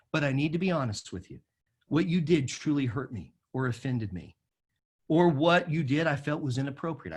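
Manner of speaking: low, soft, earnest confession; level with them quietly and sincerely; gentle honesty. Shown as a demonstration. Pastor modeling how to confront someone who hurt him, gently honest. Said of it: "this is typically how I would do it".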